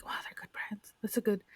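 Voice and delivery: hushed, breathy aside; mock whisper. Podcaster replaying her inner reaction in a hushed whisper. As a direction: whisper it like a secret thought.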